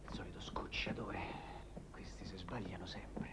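very low, whispered grumble; muttered aside. Acted. Annoyed man alone at an unexpected caller, muttered aside. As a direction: mutter it to yourself, barely audible.

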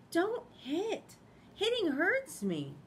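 high, slow little duck voice scolding; high scolding Ducky. Acted. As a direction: make it a small hurt duckling telling off.

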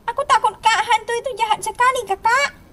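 high-pitched scared little sibling voice; Mio. Pretend. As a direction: play a frightened kid pleading to an elder. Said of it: "Mio pasti ketakutan itu".

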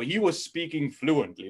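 ordinary talk

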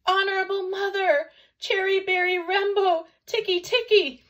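put-on high, loud, breathless child; gasped. A narrator's reading. Exhausted Chang muddling his brother's name to mother, gasping. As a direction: gasp out the jumbled name at top pitch. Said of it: "he gasped".

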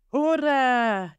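high, bright hooray, hamming up the joy; playful cheer. A narrator's reading. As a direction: jump for joy as you cheer it. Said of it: "játszott rá, ugrálva örömére". (játékos, magas hangú ujjongás)

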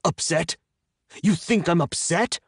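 incredulous outrage. A narrator's reading. Bound captive throwing her word back, incredulous.

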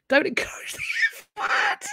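giggled protest; laughing don't encourage; laughing plea. Real, not acted.

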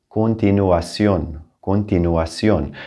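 continuación with every vowel sounded separately; all vowels pronounced. Shown as a demonstration. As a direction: say continuación carefully, each of u, a, i, o heard. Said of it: "pronouncing all of the vowels".

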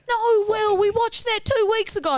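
put-on very high, loud child voice; protesting kid brother. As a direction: squeal an objection at your brother. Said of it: "Hot Henry goes".

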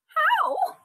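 very high, tiny questioning voice; Baby Bear. A narrator's reading. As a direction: squeak the question like a little cub. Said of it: "asked Baby Bear".

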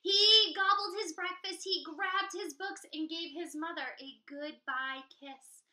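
put-on lively read-aloud for kids; storytime narration. A narrator's reading. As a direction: read it like to a class. Reading to children.

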